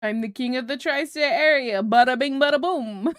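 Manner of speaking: loud, brash New Jersey wiseguy voice; mock Jersey mobster. Playing a Jersey mob boss bragging to chat, loud and brash. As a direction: boast like a Sopranos boss. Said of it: "tri-state Jersey mobster bit".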